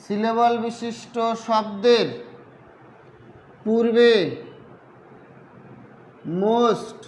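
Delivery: slow, word by word with long pauses; slow dictation. Real, not acted. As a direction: say it piecemeal as you write it out. Writing out the definition while saying it. (লিখতে লিখতে ধীরে ধীরে থেমে থেমে বলা)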